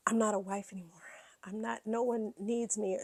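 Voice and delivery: whispering Kyle's lonely thoughts; whispered inner voice. Retold or imitated. Host voicing Kyle's thoughts, whispered. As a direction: breathe it as a whisper. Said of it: "she's feeling like".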